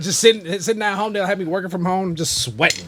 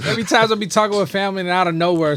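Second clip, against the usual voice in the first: usual speaking voice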